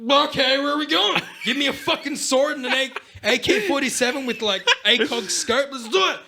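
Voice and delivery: loud, high hammed-up battle zeal; playful high-pitched war yell; mock eager recruit. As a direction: shout it like you're raring to fight. Acts drunk eager crusade recruit.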